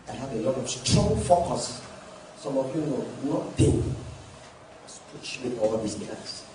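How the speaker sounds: ordinary talk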